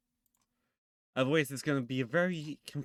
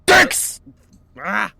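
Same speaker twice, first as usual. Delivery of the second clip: shrieking in fright, much louder; startled scream; 9 semitones higher, 17 dB louder than usual. Real, not acted. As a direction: yelp from a jump scare. Jump scare in horror game.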